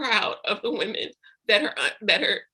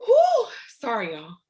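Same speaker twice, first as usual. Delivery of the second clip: tearful whew; 2 semitones higher than usual. Real, not acted. Leader apologizes to her team while crying, tearful exhale. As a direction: catch your breath through tears. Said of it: "stop crying".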